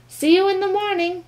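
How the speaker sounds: loud high call. A narrator's reading. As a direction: call it after the bird. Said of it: "called Stuart".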